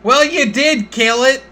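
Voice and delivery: high, loud demanding farmer voice; indignant farmer. Acted. Farmer demanding compensation for his dead bull, high and loud. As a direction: insist on being paid, outraged.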